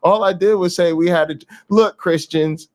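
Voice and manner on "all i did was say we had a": high, fast whiny mock of a clueless boyfriend; mocking a man who claims he did nothing wrong. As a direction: play the baffled ex who doesn't get it, pitched way up. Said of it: "don't even trip acting like".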